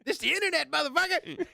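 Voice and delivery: mock shock jock yell.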